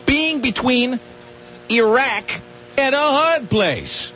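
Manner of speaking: high, slow pun delivery; punny tease. Shown as a demonstration. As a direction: draw out the pun, tease the listener. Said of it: "we'll talk about".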